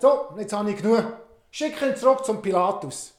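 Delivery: fed-up Herod. Acted.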